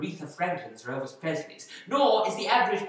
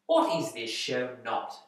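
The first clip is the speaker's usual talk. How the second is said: ordinary talk, nothing marked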